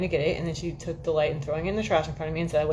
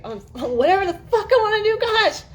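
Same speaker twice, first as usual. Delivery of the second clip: much higher, laughing through a cheeky retort; 6 dB louder than usual. Retold or imitated. Replying to people asking what she's doing, laughing and high. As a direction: toss it off with a giggle, way up high. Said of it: "I'm like".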